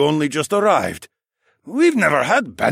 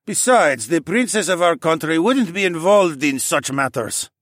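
higher, louder councilman stating the obvious; matter-of-fact; 9 semitones higher, 7 dB louder than usual. A narrator's reading. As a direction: state it as settled fact. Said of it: "in a matter-of-fact voice".

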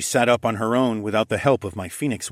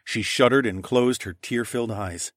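usual speaking voice

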